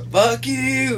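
high, shrill spat-out curse; defiant killer. Acted. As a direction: spit it back as the defiant killer. Voicing Christie's defiance.

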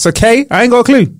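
ordinary talk

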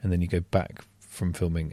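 ordinary talk

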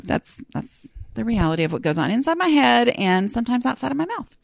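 ordinary delivery

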